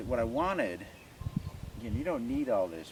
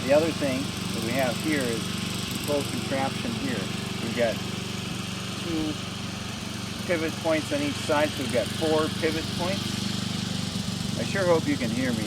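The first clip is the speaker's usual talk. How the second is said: raised voice over tractor noise; tractor rumbling behind; 4 semitones higher, 4 dB louder than usual. Real, not acted. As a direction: talk over an engine outside. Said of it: "We've got a garden tractor running in the background there".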